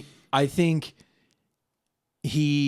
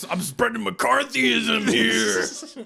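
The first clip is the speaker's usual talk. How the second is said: slow, drawn-out villain boast; mock villain slogan; 7 semitones higher, much slower than usual. Villain announcing his slogan to the world, slow mock menace. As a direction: savor it like a proud movie bad guy. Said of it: "I was gonna say".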